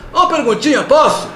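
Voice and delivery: high, eager fan asking. Retold or imitated. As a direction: pipe up like a keen audience member. Audience member asks question.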